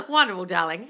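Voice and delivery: ordinary talk, nothing marked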